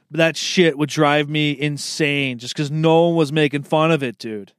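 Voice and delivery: ordinary delivery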